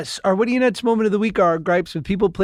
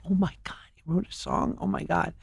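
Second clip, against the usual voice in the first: hushed, fast whispered awe; excited whisper; 2 semitones lower, 10 dB quieter, faster than usual. Retold or imitated. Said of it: "everyone goes like".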